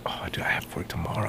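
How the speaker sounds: hushed unvoiced whisper. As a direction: whisper the excuse quickly. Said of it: "He's like".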